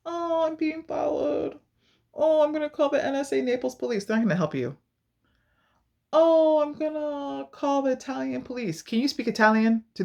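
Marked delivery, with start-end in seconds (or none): 0.0-4.0 exaggerated damsel-in-distress voice; mock helpless victim. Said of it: "You can't just be like". 6.1-8.8 high, drawn-out mock wail; exaggerated damsel-in-distress voice; mock helpless victim. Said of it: "You can't just be like".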